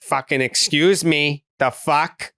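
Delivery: slow, snappy mock outrage; retold rude telling-off, a touch slow; imitating angry sister. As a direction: play the sister telling off a line-cutter. Sister mouthing off in line.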